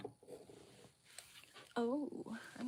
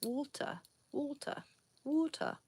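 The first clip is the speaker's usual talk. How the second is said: water as wɔltə, like Walter, higher, falling; British water; 8 semitones higher than usual. Shown as a demonstration. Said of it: "like the way they say the name Walter".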